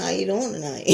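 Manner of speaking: soft, smooth imitation of the guy; his smooth low voice. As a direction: say it softly like a smooth talker. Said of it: "That's how he talked".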